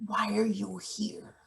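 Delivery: hushed, unvoiced aside; whisper. Boss whispering to Izzy behind the intern's back. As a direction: whisper it so the intern can't hear.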